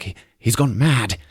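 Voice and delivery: mock panic, climbing up and dragging the last words; a hammed-up horrified soldier voice; panicked Warden. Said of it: "Warden's like".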